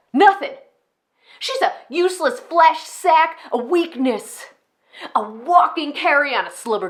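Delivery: high, loud narcissistic rant; unhinged rage. Acted. Enid rages that Mama never came, furious. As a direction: build a furious grievance, spitting out each insult. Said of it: "how insane they can sound at times".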